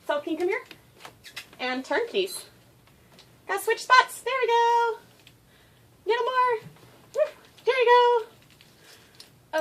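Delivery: very high sing-song dog talk. Real, not acted. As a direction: coax your dog in a high coo. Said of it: "annoying high-pitched baby voice with my dog".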